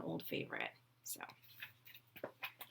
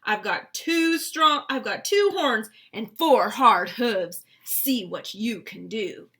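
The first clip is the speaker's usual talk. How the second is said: loud, slow boast; emphatic, measured big-goat threat; defiant big goat; 12 dB louder, slower than usual. A narrator's reading. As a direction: boom out the challenge as a huge goat. Big goat challenges troll.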